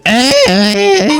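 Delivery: high, whiny fake crying; mock sobbing girl. Mocking the crying girl answering the singer, fake sobs. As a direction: imitate a little girl sobbing her answer. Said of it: "قالت له".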